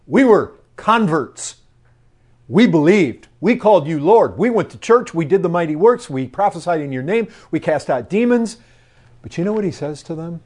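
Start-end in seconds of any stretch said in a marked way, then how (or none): 0.1-8.6 loud, indignant voice of religious people pleading their deeds; protesting churchgoers. Acted. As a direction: plead the list of good works as proof.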